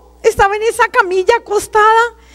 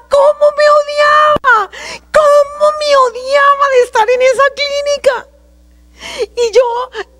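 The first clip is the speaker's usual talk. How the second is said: higher, tearful self-reproach; crying; 5 semitones higher than usual. Real, not acted. Confessing her self-hatred to the congregation, tearful. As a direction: let the crying break the words.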